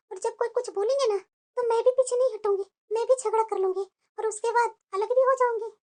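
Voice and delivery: hushed, plotting to herself; quiet scheming aside. Acted. New bride plotting to herself, hushed scheming. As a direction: murmur the plan so the family can't hear.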